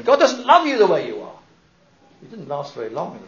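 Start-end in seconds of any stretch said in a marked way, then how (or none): 0.0-1.4 loud, high declaration; mock harsh pulpit condemnation; condemning preacher. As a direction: hammer the congregation with it. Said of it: "He was telling his congregation".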